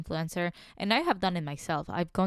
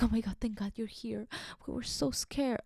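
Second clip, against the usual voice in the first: softer, higher mock relief; breathy-free soft imitation of relieved diners; relieved customers; 3 semitones higher, 7 dB quieter than usual. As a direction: play the clingy regulars, hushed. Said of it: "they're like".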